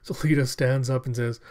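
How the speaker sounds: plain reading voice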